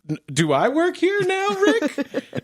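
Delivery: very high disbelieving question; incredulous squeak. Retold or imitated.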